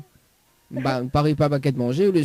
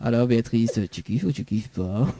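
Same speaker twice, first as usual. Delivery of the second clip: sensual voice; 4 semitones lower than usual. Pretend. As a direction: purr it like a seducer. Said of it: "si je fais une voix sensuelle".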